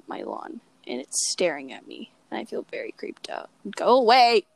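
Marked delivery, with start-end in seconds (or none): 3.8-4.5 loud mock yell to shoo something off; shooing shout. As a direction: call out a loud, higher "go away". Yelling at a staring bird.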